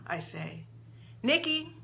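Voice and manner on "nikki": put-on higher, childlike voice; lightly voiced little girl; little girl's voice. A narrator's reading. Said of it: "in a little girl's voice".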